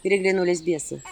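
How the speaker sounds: ordinary delivery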